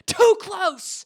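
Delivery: loud, high furious shout; a quoted hero yelling in anger; angry shout. Retold or imitated. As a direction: bark it as a protective warning. Xaden angry at near miss.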